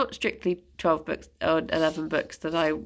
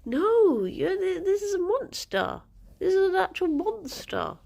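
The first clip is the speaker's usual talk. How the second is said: high-pitched playful telling-off of a pet; mock scolding; 11 semitones higher than usual. Real, not acted. Streamer to her digging pet, high mock scolding.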